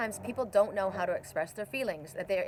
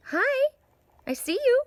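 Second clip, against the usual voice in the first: high sing-song hello to a bird; high bird greeting; 9 dB louder than usual. Real, not acted. Speaking to the mockingjay outside, high and sweet. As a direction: coo up high at the little bird.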